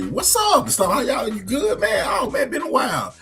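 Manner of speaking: imitating a passing acquaintance; casual greeting. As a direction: toss off a quick friendly hello. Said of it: "a familiar face is that you always gonna say".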